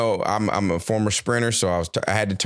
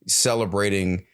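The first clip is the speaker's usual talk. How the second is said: ordinary talk, nothing marked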